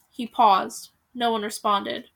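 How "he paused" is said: plain reading voice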